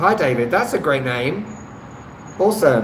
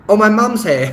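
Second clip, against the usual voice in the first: surprised; 8 semitones higher, 6 dB louder than usual. Real, not acted. Mum walks in during stream.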